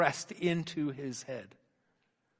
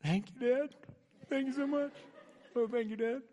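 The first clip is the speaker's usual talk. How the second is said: put-on grateful boys; 7 semitones higher than usual. Boys thanking dad, relieved.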